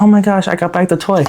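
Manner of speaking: high-pitched eager puppy voice; excited dog. Acted. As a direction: play an overexcited dog showing off to its owner. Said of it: "You look like a dog that's coming up".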